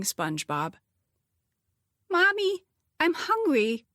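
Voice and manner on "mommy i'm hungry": put-on high, whiny small child; a hungry little kid tugging at mom; little girl voice. A narrator's reading. Little girl whining for food.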